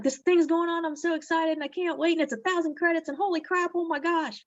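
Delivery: gushing excitement. Pretend. Seller hyping a promo to followers, excitedly high. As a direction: squeal it like a thrilled fan.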